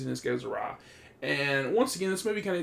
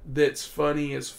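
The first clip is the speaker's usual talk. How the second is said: ordinary talk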